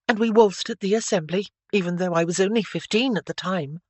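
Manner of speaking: chirpy. A narrator's reading. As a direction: chirp on eagerly without guarding yourself. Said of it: "she chirped up with the truth".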